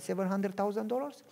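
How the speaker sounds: normal talking voice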